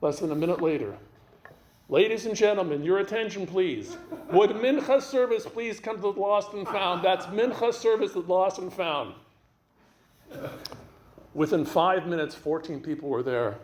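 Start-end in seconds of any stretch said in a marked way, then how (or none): 1.9-9.1 mock stadium announcement, pitched up; raised, formal announcer delivery; public announcer. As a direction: call it out like a loudspeaker page.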